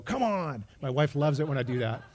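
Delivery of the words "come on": exasperated yell, pitched higher; impatient driver, mock annoyed; frustrated come on. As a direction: groan it at the red light. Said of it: "I do that thing where I go".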